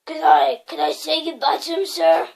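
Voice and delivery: Hagrid pleads, loud and gruff; a big man begging to say goodbye. A narrator's reading. Giant asks Dumbledore to say goodbye, loud pleading. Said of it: "asked Hagrid".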